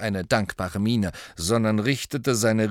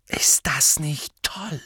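excited; 5 semitones higher, slower than usual. A narrator's reading. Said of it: "sagte Harry begeistert".

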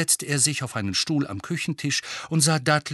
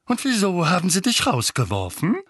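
higher, spat-out angry question; losspuckend; 7 semitones higher, 4 dB louder than usual. A narrator's reading. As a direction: fire the question out in character. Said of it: "bevor er wieder losspuckte". (gereizt ausgespuckte Frage)

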